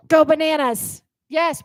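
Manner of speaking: normal talking voice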